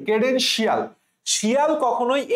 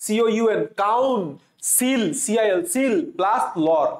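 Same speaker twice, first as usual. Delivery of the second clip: slow spelling; slower than usual. Shown as a demonstration. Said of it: "তাহলে councillor বানানটা কি?".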